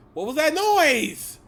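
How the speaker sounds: high, loud, slow frightened cry; scared. Real, not acted. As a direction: cry it out, spooked. Scared again by a noise.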